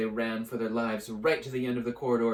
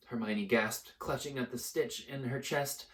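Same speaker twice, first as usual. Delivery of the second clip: plain reading voice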